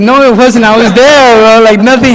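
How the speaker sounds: insist, laughing, that nothing happened; loud joking protest; mock exasperated retort. Retort to friends' bragging.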